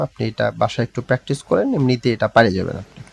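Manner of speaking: ordinary delivery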